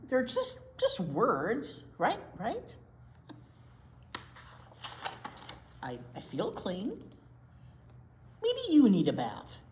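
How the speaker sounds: lightly voiced cheeky pigeon; a hint of a cheeky bird; pigeon character.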